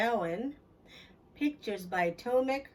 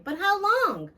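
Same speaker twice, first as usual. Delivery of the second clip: put-on much higher, louder kid's plea; pleading kid voice; whiny child; 9 semitones higher, 7 dB louder, slower than usual. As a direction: beg like a restless child in the back seat.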